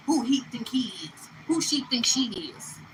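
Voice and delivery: whispered, catty gossip from onlookers. Retold or imitated. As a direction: hiss it behind a hand like a gossip. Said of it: "looking at you like".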